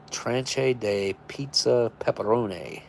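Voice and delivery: slow, careful attempt at French "Tranche de pizza pepperoni"; sounding out "Tranche" as tran-shay, unsure; mock French reading. Shown as a demonstration. Reading the French label off an MRE menu, slow and unsure. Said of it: "I'm sure that's not how you say that".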